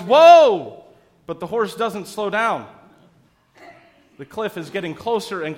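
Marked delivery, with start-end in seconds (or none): 0.0-0.8 big, loud, high Whoa; yelled whoa. Acted. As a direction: yell it to stop a runaway horse. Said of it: "and he yells".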